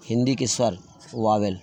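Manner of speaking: ordinary talk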